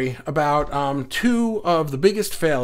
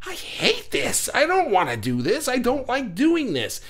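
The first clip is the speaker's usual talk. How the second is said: fed-up whine; 8 semitones higher than usual. Retold or imitated. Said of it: "I turn around and go".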